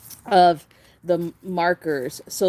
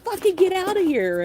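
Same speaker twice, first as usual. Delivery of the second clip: indignant; 13 semitones higher than usual. Retold or imitated.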